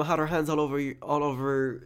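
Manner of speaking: ordinary delivery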